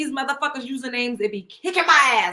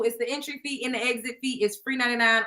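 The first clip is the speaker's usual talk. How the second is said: normal talking voice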